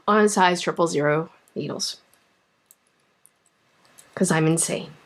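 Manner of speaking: low and deflated after a sigh; resigned. Real, not acted. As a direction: let the air out of it.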